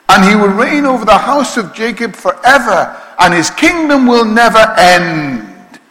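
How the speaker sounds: loud, high proclaiming of scripture; full-voiced scripture proclamation; preaching at full force. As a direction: declare the angel's promise with fervour. Preaching the angel's words.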